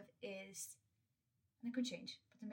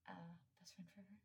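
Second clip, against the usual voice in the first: whisper; 11 dB quieter than usual. Real, not acted. Tender aside about her friend.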